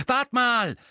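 hoch und laut dazwischenrufen; laut unterbrechen; laut eingeworfen. A narrator's reading. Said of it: "warf George laut ein". (Bruder unterbricht laut das Gespräch)

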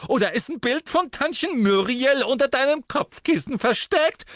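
very high, loud jeering; shrill scornful laughter. A narrator's reading. Sister jeers at her brother, shrill and scornful. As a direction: crow mock laughs, then taunt at a shout. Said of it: "brach in schrilles Hohngelächter aus".

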